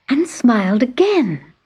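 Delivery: plain reading voice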